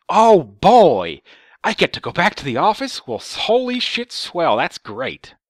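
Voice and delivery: high, loud mock enthusiasm; sarcastic delight. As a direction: gush sarcastically about unwelcome news.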